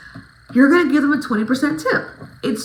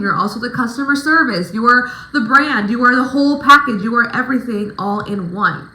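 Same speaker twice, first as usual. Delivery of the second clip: ordinary delivery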